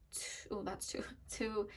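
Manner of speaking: hushed whisper with a laugh; whispered countdown. Real, not acted. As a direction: drop to a whisper mid-countdown. Whispered trigger warning countdown.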